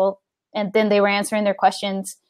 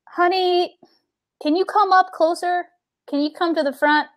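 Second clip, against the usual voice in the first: kind coaxing; 7 semitones higher than usual. Retold or imitated. Player speaks kindly to child.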